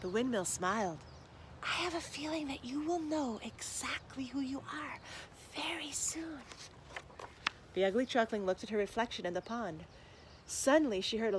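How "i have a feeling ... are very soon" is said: soft breathy whisper of a knowing promise; secret whisper. Acted. Windmill confides a secret.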